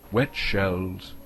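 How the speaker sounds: ordinary delivery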